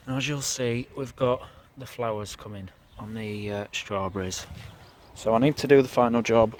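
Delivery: usual speaking voice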